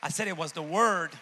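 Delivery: loud preacher's shout; forceful, booming insistence; emphatic repeat. Real, not acted. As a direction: belt it out to the congregation. Preacher repeats the answer loudly.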